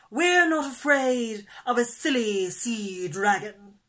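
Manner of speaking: loud, scornful bravado; boastful. A narrator's reading. Turtle scorning the dragon, loud boast. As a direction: brag with big drawn emphasis. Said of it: "boasted Turtle".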